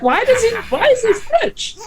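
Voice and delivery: high-pitched mock bafflement; retold squeaky disbelief; baffled question. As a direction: ask it baffled, pitch jumping way up. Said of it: "We're like".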